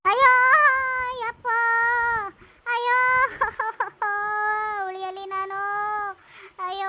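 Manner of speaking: acted agony, words trailing into moans; moaning in pain. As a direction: groan like someone just beaten. Beaten mother moans in pain.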